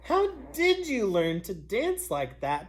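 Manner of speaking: high, loud eager begging; eager pleading. Acted. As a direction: beg him excitedly. Animals beg Gerald.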